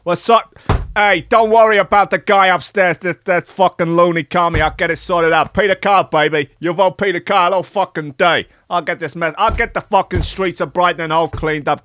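hammed-up Italian American tough guy; Italian American accent. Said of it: "he might be American Italian".

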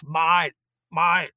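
loud, high, rough Aussie call from the trailer; Ben calling out. Retold or imitated. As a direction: shout him over, mate to mate. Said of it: "And then I hear this".

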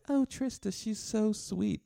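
high-pitched mock gushing; gushing admirers. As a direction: imitate fawning fans in a high, drawn-out coo. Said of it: "and they're like".

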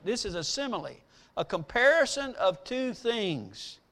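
ordinary talk, nothing marked

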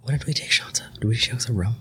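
whispered aside; whispering. Real, not acted. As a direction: ask it under your breath.

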